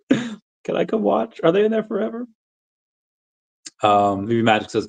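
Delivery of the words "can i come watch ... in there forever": put-on cutesy childlike voice. Repeating a chatter's adorable question in a cutesy kid voice. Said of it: "laughs, repeating the cute quote".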